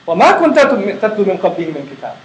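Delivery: swift, melodic Arabic recitation; Quran recitation. Acted. Teacher reciting the verse to students, flowing Quranic chant. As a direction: chant the verse in one quick flowing breath.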